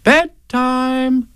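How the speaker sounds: high, lilting motherly voice; Kanga. Acted. Kanga looking round for Rabbit, motherly and high. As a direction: lift it high like a fussing mother.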